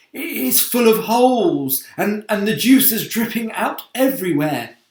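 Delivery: high loud put-on old voice; alarmed old grasshopper voice. Old grasshopper peering over the side, high alarmed voice. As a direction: cry it out in alarm.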